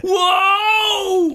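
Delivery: high, bright whoa of excitement; excited whoa. Pretend. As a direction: gasp out a thrilled whoa. Mock scared reaction to intro.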